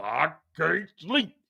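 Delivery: low, slow mocking whine; mocking Kylo whining. Mocking King Kylo's sleepless whine, low and slow. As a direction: drag it out low like a weakling who can't sleep. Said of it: "King Kylo".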